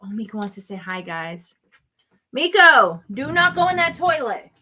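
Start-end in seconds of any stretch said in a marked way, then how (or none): 2.3-4.5 raised, high-pitched warning to the dog; calling out scolding. Real, not acted. Woman warns her dog away from the toilet, scolding. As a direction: sharply call the dog off.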